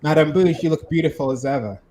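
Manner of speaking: low smug suitor voice; deep character voice. Acted.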